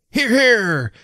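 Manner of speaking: high-pitched drawn-out cry of approval; cheering listener. A narrator's reading. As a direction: cheer from the table. Company cheers the speaker.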